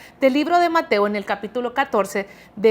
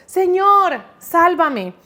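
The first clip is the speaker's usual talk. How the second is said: loud, high acted cry for rescue; grito de auxilio; 5 semitones higher, 7 dB louder, much slower than usual. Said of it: "empezó a hundirse y gritó". (grito de socorro actuado)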